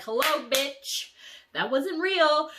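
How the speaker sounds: loud, high taunting voice; sassy reality voice. Retold or imitated. Reality personified mocking her after a happy dream.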